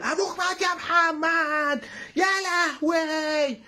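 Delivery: very high, drawn-out lament; imitation keening, stretched and high; mock anguished wail. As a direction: wail it slowly as a hammed-up mourner. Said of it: "يا لهوي اللي بحرقة دي".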